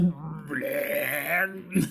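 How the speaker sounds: long, drawn-out fake gag; exaggerated "bleh" of nausea; mock retching. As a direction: imitate the kid heaving. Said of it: "wanting to throw up".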